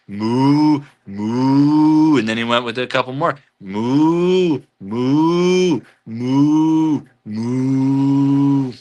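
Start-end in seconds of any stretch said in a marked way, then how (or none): 0.1-2.2 a cow's moo, drawn out. Pretend. Reading Joe's moos aloud to chat, cow moo. 3.6-8.7 a cow's moo, drawn out. Pretend. Reading Joe's moos aloud to chat, cow moo.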